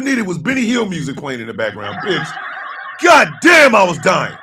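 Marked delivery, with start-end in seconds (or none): 3.0-3.7 loud, high exclamation. Real, not acted. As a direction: belt out the outburst.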